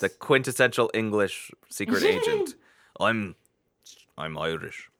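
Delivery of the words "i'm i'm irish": failed Irish-Scottish accent attempt; half-hearted go at an Irish accent; tentative, low stab at an Irish accent. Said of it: "Nah, I, I can't do it".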